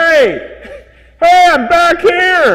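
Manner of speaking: high shout from behind; calling out. Acted. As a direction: call out in a high voice. Puppet calling out.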